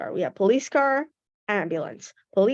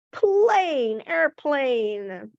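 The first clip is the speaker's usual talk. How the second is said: big reveal; 4 semitones higher, much slower than usual. Shown as a demonstration. Teacher revealing the answer with excitement. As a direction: lift up on plane like a reveal. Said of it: "And the answer is".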